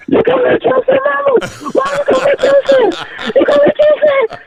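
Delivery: very high squealing re-enactment, laughter breaking in; losing it. Retold or imitated. Son losing it at mama's surprise drum set, acted squeal. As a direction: squeal it in overjoyed disbelief, half laughing. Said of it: "lost it. I was like".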